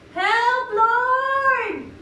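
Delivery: high, loud cry with a long-held last word; full-voiced desperate shout; shouted plea. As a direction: call out to heaven for help. Models a shout for help.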